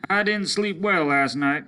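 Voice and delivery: tired man complaining; weary soldier. Acted. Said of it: "he said".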